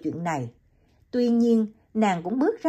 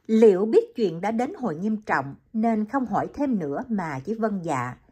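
ordinary delivery

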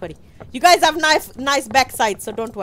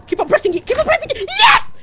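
rising from high call to shriek; frantic urging; 13 semitones higher, 7 dB louder, faster than usual. Real, not acted. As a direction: urge on frantically, then cheer. Urging teammate under pressure.

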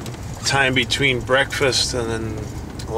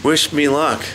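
normal talking voice

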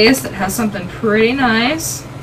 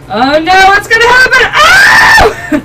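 high, loud alarm, slower; scared shout; 10 semitones higher, 6 dB louder, slower than usual. Real, not acted. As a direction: cry out in sudden fear. Angry bull charging.

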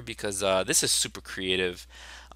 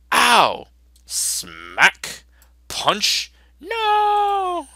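higher and louder comic yelps; shouted cartoon fight noises; 8 dB louder, much slower than usual. Teacher acts out the comic's fight noises loudly. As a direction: belt out each hit like a cartoon.

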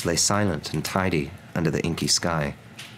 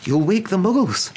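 very high, louder reprimand; urgent scolding; 14 semitones higher, 5 dB louder than usual. A narrator's reading. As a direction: scold them in a high, strained voice. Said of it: "hissed Professor McGonagall".

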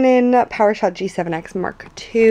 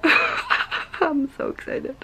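giggly whisper; 8 semitones higher, much slower than usual. Real, not acted. Laughing, whispering over footage.

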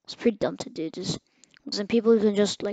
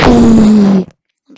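very loud, slow wail of sorry; loud drawn-out sorry; 19 dB louder than usual. Real, not acted. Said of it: "I'm so loud".

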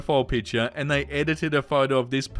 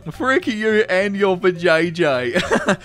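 laughing reading, louder; laughing through it; 3 semitones higher, 4 dB louder than usual. Real, not acted. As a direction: repeat it while cracking up. Repeats line while laughing.

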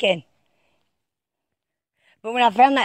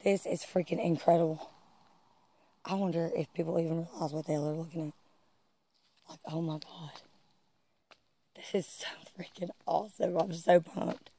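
much softer, murmured under the breath; hushed awe; 3 semitones lower, 14 dB quieter than usual. Real, not acted. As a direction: keep it low, amazed.

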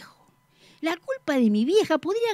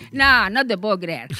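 usual speaking voice

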